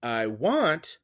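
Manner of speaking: "I want" with light I and strong want; iambic stress. Shown as a demonstration. Teacher showing unstressed then stressed, weight on want. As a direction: lean into want after a light I. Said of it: "we have the unstressed syllable first, and then the stressed one".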